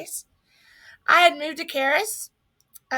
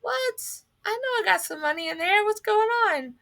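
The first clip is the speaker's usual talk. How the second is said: shocked disbelief; 8 semitones higher than usual. Retold or imitated. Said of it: "And I thought".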